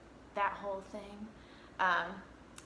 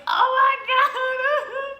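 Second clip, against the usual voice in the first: loud, high, drawn-out wail; mock breakdown wail; 10 dB louder, much slower than usual. Retelling breaking down in a friend's hug, loud mock wail. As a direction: wail as if finally breaking, hammed-up.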